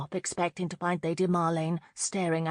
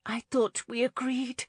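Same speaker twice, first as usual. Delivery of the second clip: desperate murmur; 6 semitones higher than usual. Acted. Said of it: "she murmured desperately".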